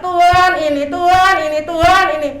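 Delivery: high, loud pleading mock prayer; imitating a nagging beggar's prayer; begging prayer. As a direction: whine at God like a child asking for things. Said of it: "nggak boleh terus minta-minta".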